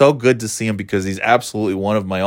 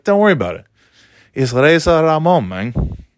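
higher, breezy brush-off; waving the question away with swagger; cocky dismissal; 7 semitones higher than usual. Retold or imitated. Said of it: "I'm like".

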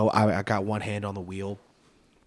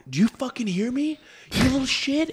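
furious yell; 13 semitones higher than usual. Retold or imitated. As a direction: scream it at the kid in rage. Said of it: "he's like".

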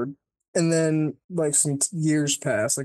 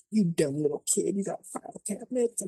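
higher, sneering witch taunt, trailing off softer; mocking witch; 4 semitones higher, 3 dB quieter than usual. The vampire witch mocking Abra's filed-away mind, sneering. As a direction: sneer at the kid like a smug villain.

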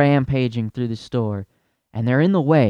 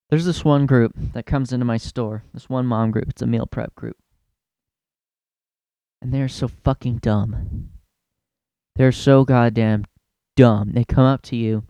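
normal talking voice

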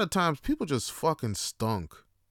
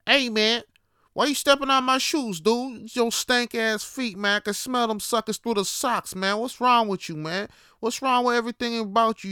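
bus arguer; 7 semitones higher than usual. Retold or imitated. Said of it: "for instance".

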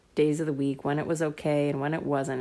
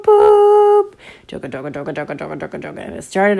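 train noises; 11 dB louder than usual. Acted. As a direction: imitate the train starting up. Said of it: "they went boop".

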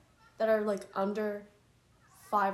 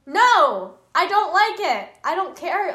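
loud, higher, stubborn refusal; childish protest; 7 semitones higher, 14 dB louder, slower than usual. Retold or imitated. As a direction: yell no like a fussy kid. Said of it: "I was like".

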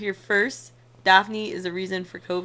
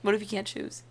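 ordinary delivery

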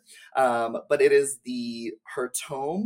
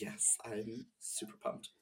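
whisper; 16 dB quieter, slower than usual. Real, not acted. Excited reply, whispered.

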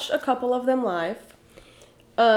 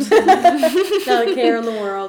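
laughing; 7 dB louder, slower than usual. Pretend. Laughing joke about drinking.

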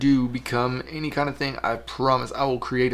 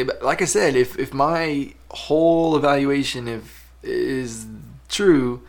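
ordinary talk

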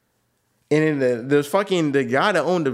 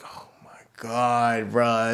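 slow, breathy whisper of disbelief; oh my God under the breath; whispered dismay; much slower than usual. Retold or imitated. Driver whispering as girl starts crying.

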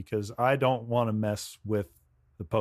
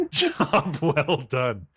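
very slow, laughing smug drawl; slow smug echo; much slower than usual. Retold or imitated. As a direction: stretch it out through a laugh. Echoing the smug line.

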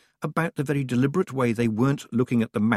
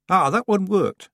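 high village woman; 10 semitones higher, 7 dB louder than usual. A narrator's reading. Village woman answering Tiffany.

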